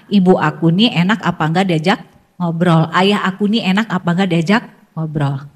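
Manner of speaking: put-on quiet wondering child; child's inner voice. Shown as a demonstration. Child softly wondering whether parents are easy to talk to. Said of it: "memberikan sinyal kepada anak".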